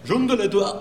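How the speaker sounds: high, mumbled fake French; mock French mumbling. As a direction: swallow the sounds in fake French. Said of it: "French is like".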